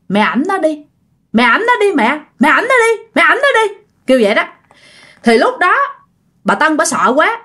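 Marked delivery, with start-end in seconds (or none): 0.1-3.7 insistent, rising higher with each repeat; high, mounting, insistent begging; urgent ghostly pleading. Acted. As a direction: press the plea again and again, pitch climbing. Ghost voiced urging mother.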